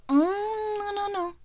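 high-pitched hummed no-no-no; pouty refusal. Acted. Girlfriend refusing to continue the game, high pouty voice. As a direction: refuse in a high sulky whimper.

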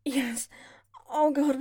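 ordinary talk

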